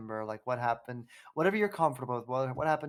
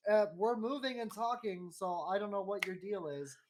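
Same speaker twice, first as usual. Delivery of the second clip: higher, prissy mock-polite voice; mock posh voice; 7 semitones higher, 7 dB louder than usual. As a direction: play TikTok as a snooty host. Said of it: "What a time for TikTok to be like".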